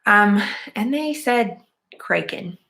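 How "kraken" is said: "Kraken" with a long A, as CRAY-ken; mispronounced Kraken. Retold or imitated. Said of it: "instead of Kraken, and I didn't like that".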